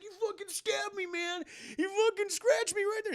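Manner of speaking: very high, strained-up imitation; hammed-up injured victim; mock wounded complaint. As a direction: whine about the wound in a high voice. Voices wounded boyfriend complaining.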